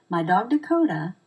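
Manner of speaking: "Dakota" stressed with a lift in pitch; one thought group, its weight on "Dakota". Shown as a demonstration. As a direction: lean on the word "Dakota". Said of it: "I stress the word Dakota".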